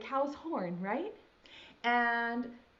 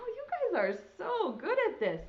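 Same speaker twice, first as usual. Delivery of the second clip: ordinary talk, nothing marked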